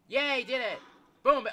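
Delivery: loud, high celebration; triumphant cheer. Real, not acted. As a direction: cheer your own success. Succeeds at a game task.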